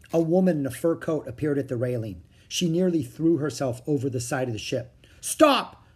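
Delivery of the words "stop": high, loud shouted order; urgent raised yell; calling out. A narrator's reading. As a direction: shout a sudden command. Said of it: "the uniformed man called".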